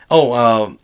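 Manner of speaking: normal talking voice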